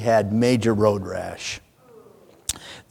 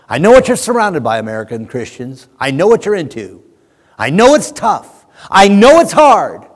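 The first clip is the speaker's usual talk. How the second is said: louder and higher, slowing at the end; preaching God's voice; 5 semitones higher, 8 dB louder than usual. Retold or imitated. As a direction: build up as God speaking to the flock. God voiced, preacher building.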